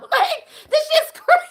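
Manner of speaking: high, loud laughing exclamation; cackling at how crazy it was; laughing disbelief. Real, not acted. Laughing at the absurdity.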